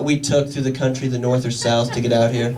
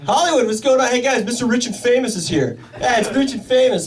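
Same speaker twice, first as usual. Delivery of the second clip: teasing hometown buddies; 10 semitones higher than usual. Retold or imitated. Hometown friends teasing in bar.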